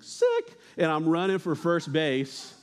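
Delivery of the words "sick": mock stoked. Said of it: "I was like".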